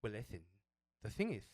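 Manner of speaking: imitating a lisping, sassy trainer; mock Spanish lisp, a little prissy. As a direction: lisp the s sounds with sass. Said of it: "trying to just be a sassy guy".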